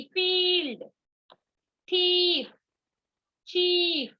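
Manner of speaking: फील्ड, थीफ, चीफ with high, stretched "ee"; long I-E sound. Shown as a demonstration. Teacher drills I-E words, long high "ee" in फील्ड and चीफ. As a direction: hold the vowel, then let it fall in थीफ and चीफ. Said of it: "आई का sound long हो जाती है".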